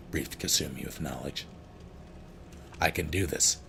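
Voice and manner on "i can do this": ordinary delivery, nothing marked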